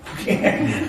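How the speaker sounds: a breathy chuckle at himself; self-mocking laugh. Real, not acted. Laughing after admitting to the listener he is a yapper. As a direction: laugh at yourself, airy.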